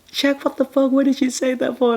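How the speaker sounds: high, shocked mock protest; imitating startled relatives; shocked outburst. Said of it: "they're like".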